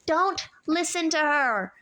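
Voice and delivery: loud, high warning; shouted. A narrator's reading. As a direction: shout across the garden. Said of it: "Annabeth's voice shouted".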